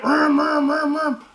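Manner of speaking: high, loud imitated vroom of a motorcycle; engine revving noise. Imitating the angry biker revving at him, vroom noise. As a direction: mimic the bike revving.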